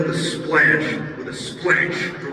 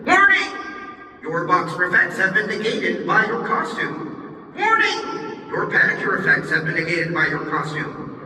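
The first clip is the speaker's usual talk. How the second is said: system announcer; 7 semitones higher than usual. Acted. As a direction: announce each warning crisply. System announcer reads warnings.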